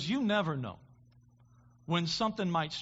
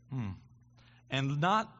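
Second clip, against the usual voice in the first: normal talking voice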